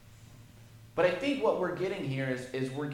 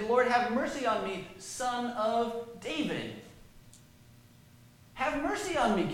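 pleading woman; 8 semitones higher, 4 dB louder than usual. Retold or imitated. Preacher voices the woman's plea.

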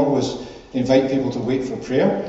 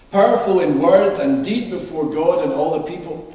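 measured public Bible reading, lifted and deliberate; scripture reading; 4 semitones higher, 4 dB louder than usual. Acted. Minister reading the Emmaus Road story to the congregation, scripture reading. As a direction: read it out clearly to a congregation, phrase by phrase. Reads the Bible passage aloud.

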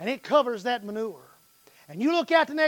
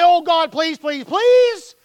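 mock pleading, louder and higher; mock begging; 6 semitones higher, 12 dB louder, slower than usual. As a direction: beg God in a hammed-up plea. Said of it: "Didn't have to say".

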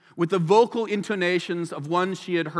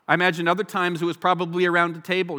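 normal talking voice

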